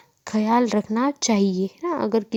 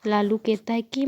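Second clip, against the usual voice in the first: normal talking voice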